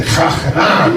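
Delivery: "Graag gedaan" with a throaty Dutch g, laughing; Dutch phrase laughing. Retold or imitated. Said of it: "all she heard was me say".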